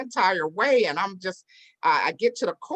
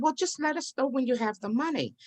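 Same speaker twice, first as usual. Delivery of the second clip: high, easygoing imitation of kindly sellers; obliging high voice, retold; reassuring couple; 8 semitones higher than usual. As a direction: mimic them pitched up, no pressure. Smiling couple reassures her.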